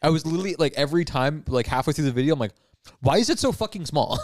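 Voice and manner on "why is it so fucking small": mildly exasperated retold complaint, pitched higher; irrationally angry. Retelling his reaction to small videos, irrationally angry. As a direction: replay your own irritation, a touch heightened. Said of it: "I was getting irrationally angry".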